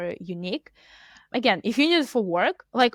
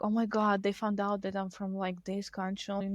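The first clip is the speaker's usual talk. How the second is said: acted hushed embarrassment, trailing softer; mock dismay; 6 dB quieter than usual. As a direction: play mortified, sinking to a small voice. Acted dismay at being found out.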